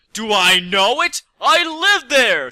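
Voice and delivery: high, slow gleeful exclamation; gleeful shout. A narrator's reading. Pinkie answering the mech, gleeful high shout. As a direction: bubble over with delight, stretch it out. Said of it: "she shouted with glee".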